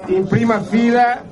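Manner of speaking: ordinary talk, nothing marked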